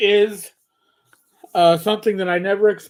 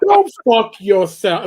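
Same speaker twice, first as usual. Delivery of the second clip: put-on New York accent; 4 semitones higher, 7 dB louder, slower than usual. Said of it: "in my best New York accent".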